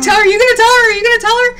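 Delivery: high, loud, bursting to share; can't hold the secret in; eager nurses. Retold or imitated. Nurses urging each other to reveal, eagerly high. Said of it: "they were just like".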